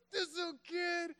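high-pitched mock indignation; a grown man's put-on squeaky grumble; high exasperated grumble. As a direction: squeak out the outrage at a kid. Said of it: "be like".

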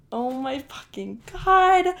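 loud, drawn-out exasperated cry; mock-outraged groan of disbelief in words; retold exasperation. Acting out her exasperated reaction.

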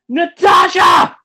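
loud, high, drawn-out shout of a name; reenacted yell. Man calling out to his date across the theater, yelling. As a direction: holler across a theater as in a retelling. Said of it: "And I just yell".

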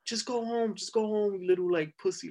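high, mocking cop voice throwing it back; mock the cop talking down to a kid; imitated cop retort. Cop sneering at the mouthy teen, high mocking retort.

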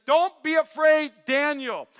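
loud, slow, commanding angel voice; a mighty angel calming a man on the ground; booming angel. Retold or imitated. Angel appears to Daniel.

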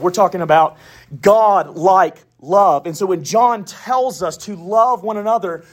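ordinary talk

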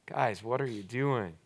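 mild disappointed question; disappointed plea. Shown as a demonstration. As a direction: gently let down. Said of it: "less aggressive and it's more just like".

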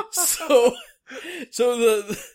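high giggly speech; barely holding in laughter; laughing while talking. Real, not acted.

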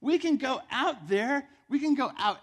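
full-force preaching. Acted. Preacher tells congregation the scripture is about them, forceful preaching. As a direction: preach it with force, punching each YOU.

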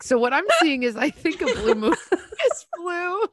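high, laughing newscaster; news reporter voice. Pretend. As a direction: play a clueless TV reporter. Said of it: "you should be on the news".

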